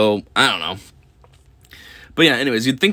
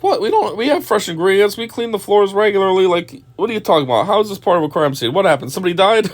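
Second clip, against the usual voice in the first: mock indignant owner; 7 semitones higher than usual. Voicing owner's inner indignation.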